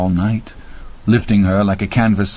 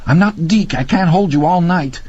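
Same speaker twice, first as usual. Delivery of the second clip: strained snap; 9 semitones higher than usual. A narrator's reading. Randy strained.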